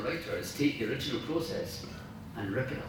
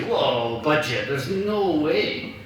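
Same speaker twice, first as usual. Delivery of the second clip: balking film director; 3 semitones higher, 8 dB louder, much slower than usual. Retold or imitated. Film director refusing a costly scene, loud mock protest. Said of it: "And they say".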